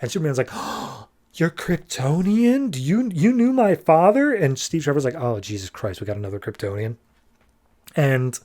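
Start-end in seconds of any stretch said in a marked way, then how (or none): 0.5-4.3 a breathless oh, then much higher and amazed; stunned surprise. Retold or imitated. Superman meets a fellow Kryptonian. 5.1-7.0 softer and lower, fed-up under the breath; exasperated grumble. Retold or imitated. Steve groans at new Kryptonian.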